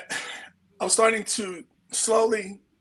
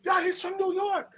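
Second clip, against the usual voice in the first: high, bright mock excitement; imitating delighted kids, pitched up; excited Norwegian kids; 9 semitones higher, 4 dB quieter than usual. Norwegian kids hearing he's from New York, excited. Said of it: "kids asking questions".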